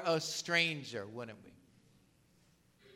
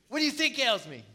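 higher, put-upon comeback; exasperated retort; 6 semitones higher, faster than usual. Retold or imitated. Hagar snapping back at the angel, exasperated. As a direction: throw the question back, fed up.

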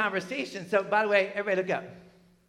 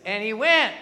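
loud, higher, emphatic scripture reading; 5 semitones higher, 12 dB louder, much slower than usual. Shown as a demonstration. Preacher leading congregation through the last statement, loud reading. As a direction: lead the room in reading aloud. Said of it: "Read it out loud".

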